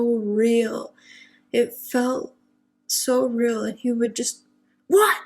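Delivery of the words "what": short, much higher, louder What with a rise; sudden yell. Retold or imitated. Brother's voice yelling in my ear while I sleep, sudden sharp shout. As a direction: snap it out right into the ear. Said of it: "right in my ear".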